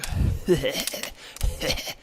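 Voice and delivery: breathy, voiceless acted chuckle; low chuckle. Player demonstrating Hakon's reaction, low chuckle. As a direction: let slip a put-on rasping chuckle. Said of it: "lets slip a low chuckle".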